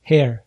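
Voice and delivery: airy h opening the word Hair; breathed English H. Shown as a demonstration. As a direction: begin "Hair" on a light exhaled h. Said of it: "на выдохе в английском языке идёт".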